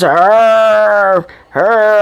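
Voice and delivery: long drawn-out imitated wail; mock bawling. Shown as a demonstration. Happy she isn't like this all the time, mock bawling.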